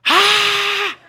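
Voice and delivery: a long, raw, high scream at full volume; loud scream. Retold or imitated. Frustrated dad alone on the back porch, letting out a loud, high scream. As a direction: let out all the frustration in one big scream. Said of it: "and I screamed really loud".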